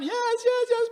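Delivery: high, gushing imitation of a tearful yes; mock gushing yes. Pastor imitating the expected fiancée, mock gushing. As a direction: squeal the yeses like an overjoyed fiancée, hammed-up.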